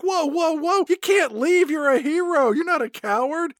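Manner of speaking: squeaky hammed-up hero plea; high mock pleading. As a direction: pitch it up, pleading earnestly. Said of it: "Patrick Sullivan's like".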